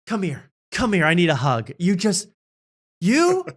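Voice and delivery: mock-emotional pull-in for a hug; lightly voiced gushing hostess; Drew gushing. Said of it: "You could say".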